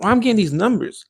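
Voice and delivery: high, fast baffled question; mock confusion at the numbers. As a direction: puzzle over the bad sum. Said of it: "I'm like".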